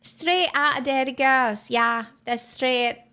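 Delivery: hammed-up Irish brogue; Irish accent. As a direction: play up a dubious Irish accent. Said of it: "you all enjoy my absolutely Irish accent".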